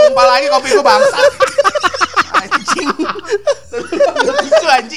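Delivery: high shocked laughing curse; spilled coffee shock. Real, not acted. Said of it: "ngelap kopi".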